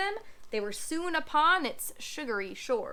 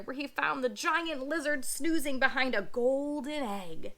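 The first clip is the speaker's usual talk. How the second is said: plain reading voice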